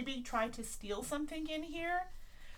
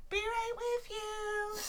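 shopkeeper voice; 11 semitones higher, slower than usual. Acted.

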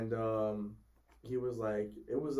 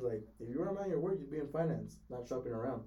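ordinary talk

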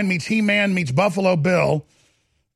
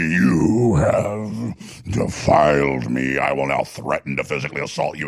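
deep mock voice.

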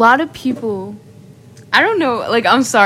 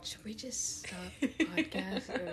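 whispering; 3 semitones lower, 22 dB quieter than usual. Real, not acted. Whispered aside during laughter.

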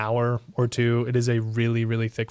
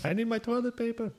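high tiny voice; 12 semitones higher, 5 dB quieter, faster than usual. Acted. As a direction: squeak it out like a little creature. Tiny voice asks for toilet paper.

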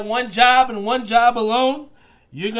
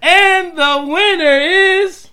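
hyped giveaway announcement; 8 semitones higher, much slower than usual. Acted. Drawing out the announcement.

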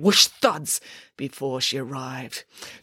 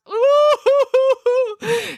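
high loud sobbing wail; big tearful bear wail; loud emotional blubbering; 5 dB louder than usual. Acted. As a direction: howl with feeling. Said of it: "And so did Boris".

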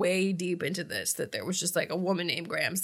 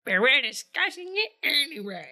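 higher teasing echo of the line; mocking echo; 6 semitones higher than usual. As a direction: parrot it back to tease her. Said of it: "my partner's so mean about it".

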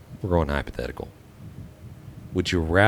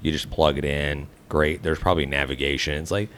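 normal talking voice

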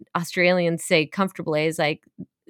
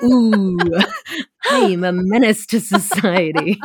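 giggly, gleeful boast; laughing mock villain; 4 dB louder than usual. Tipsy woman gloating to friends with a laugh. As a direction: relish being the menace. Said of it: "I was like".

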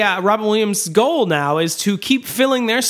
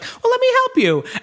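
much higher, falsetto offer to help; mock woman's voice. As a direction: pipe it up like a sweet helper. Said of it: "she's like".

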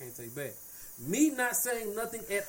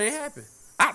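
usual speaking voice

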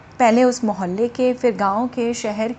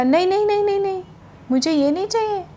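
higher, quick protesting no; urgent refusal; 7 semitones higher, faster than usual. Retold or imitated. Seller refusing the handful of grain, higher-pitched protest. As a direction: wave off the offer eagerly.

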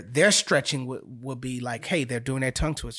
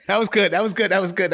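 higher and louder, cheering him on; excited praise; 7 semitones higher, 6 dB louder, faster than usual. Real, not acted. Coach cheering the student's tongue twister, excited. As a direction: burst of delighted praise for the student.

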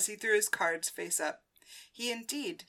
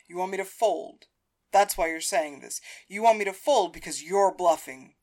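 lightly acted accusation, louder; Hector, accusing; 8 dB louder than usual. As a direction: press the accusation, pointed and loud. Voices Hector accusing Paris.

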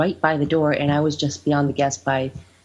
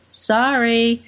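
higher-pitched, drawn-out "sorry"; retold breezy apology; 7 semitones higher than usual. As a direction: toss off a mock-sweet apology. Retold quip in door story.

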